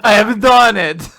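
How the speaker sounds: loud hammed-up proclamation; mock triumph. Don finally happy now, loud mock-triumphant proclamation. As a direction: announce it loudly, as a great feat. Accented Don declares victory.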